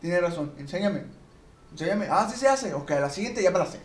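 high-pitched mock challenge to a boss; challenging worker. Worker asking the boss to teach him, high-pitched mock challenge.

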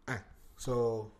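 usual speaking voice

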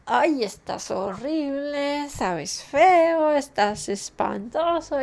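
insultos lentos y marcados de niño enojado; niño enojado imitado. Retold or imitated. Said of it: "cuando se sentían enojados". (niño imitado insultando al arroz con enojo)

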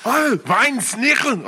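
high, loud, slow mock German; stretched-out cartoon German shout; German accent. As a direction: belt out a hammed-up German accent. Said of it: "we do the German one".

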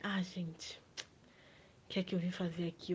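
breathy whispered aside; whispering. Acted. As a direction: whisper it to yourself. Confuso, fala baixinho.

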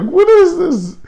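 high-pitched baffled question, acted out; mock bafflement. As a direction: replay your confusion way up high. Acts out own baffled reaction.

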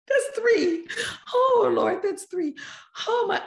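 high-pitched comic fretting; mock panic. Bride recalling her wedding-day alarm, mock panic. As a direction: squeal it in a hammed-up fluster.

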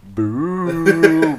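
spooky boo. Pretend.

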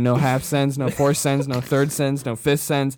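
loud bro chant; frat bro. Real, not acted. Chanting full send hype.

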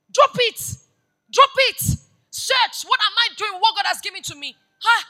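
loud, high, driving sermon delivery; full-force preaching. Real, not acted. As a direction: preach it hard to the crowd.

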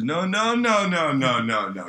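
high run of noes, swooping down; insistent refusal. Retold or imitated. Cook refusing to shut up for his manager, high insistent noes. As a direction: rattle off noes, high then falling.

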